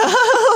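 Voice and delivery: high giggling burst; burst of high laughter. Real, not acted. Laughing at her own pun to friend. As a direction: laugh out at the joke.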